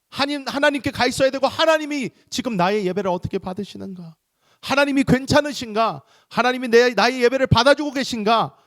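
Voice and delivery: high, forceful sermon delivery; impassioned preaching. Real, not acted. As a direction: drive it home at high pitch, urging the congregation.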